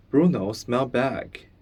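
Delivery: ordinary delivery, nothing marked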